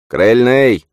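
loud, high call; shouting. A narrator's reading. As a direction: holler it out as a command. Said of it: "крикнул он".

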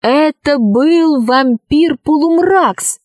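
plain reading voice

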